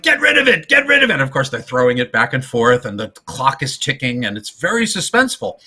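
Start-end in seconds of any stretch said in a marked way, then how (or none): 0.0-1.2 high, loud frantic repeat; mock panic, shouting at Lou to ditch it; retold frantic yelling, high and loud; panicked yell. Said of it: "So Bud's like".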